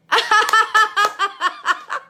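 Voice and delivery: loud, high belly laugh; helpless high cackling laugh; burst of laughter. Real, not acted. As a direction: crack up at full volume.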